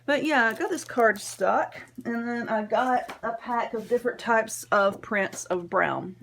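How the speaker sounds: normal talking voice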